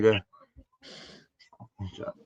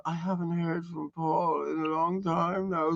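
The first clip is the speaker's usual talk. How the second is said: mocking the wife; 6 semitones higher, 8 dB quieter, slower than usual. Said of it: "his wife was on the thing going".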